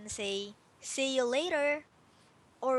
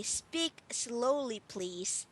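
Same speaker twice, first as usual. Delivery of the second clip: slow, careful teacher model; slow model phrase; much slower than usual. Shown as a demonstration. Teacher modelling a phrase slowly for her student. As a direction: say it slowly so the student can copy. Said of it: "So you say".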